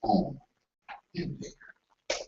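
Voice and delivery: hushed, wondering aloud; soft bewildered whisper; puzzled whisper. Retold or imitated. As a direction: murmur to yourself outside the door. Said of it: "you're like".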